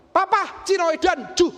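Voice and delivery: high, loud mock angry outburst; marah. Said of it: "Terus dia marah". (bentakan anak marah, tinggi, dibuat-buat)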